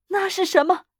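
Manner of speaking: put-on high, loud old woman's cry; startled cry. A narrator's reading. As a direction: cry out in alarm, pitched high. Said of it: "老妇人喊道". (惊慌地高声喊)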